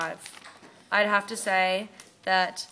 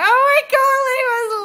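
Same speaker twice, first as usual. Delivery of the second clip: very high, loud frightened squeal; high panicked "leave us alone"; panicked plea; 10 dB louder than usual. Retold or imitated. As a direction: squeal it like a scared girl begging him to go. Panicked girls voiced in story.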